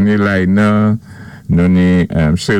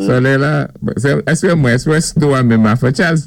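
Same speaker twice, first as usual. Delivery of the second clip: excited greeting; 6 semitones higher than usual. Retold or imitated. Mimics a friend greeting Charles.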